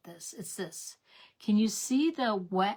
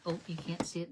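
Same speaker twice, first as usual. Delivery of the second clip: ordinary talk, nothing marked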